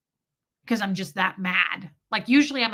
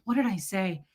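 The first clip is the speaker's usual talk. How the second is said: usual speaking voice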